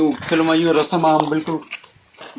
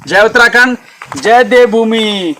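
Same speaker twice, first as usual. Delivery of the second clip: rousing slogan; 6 semitones higher, 3 dB louder, slower than usual. Real, not acted.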